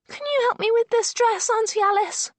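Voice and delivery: put-on high, loud small child; sweet little girl asking. A narrator's reading. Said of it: "she says in her little voice".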